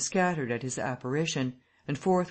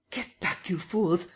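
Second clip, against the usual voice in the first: muffled cry; 3 semitones higher than usual. Acted. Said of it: "cried the muffled voice of Kemp".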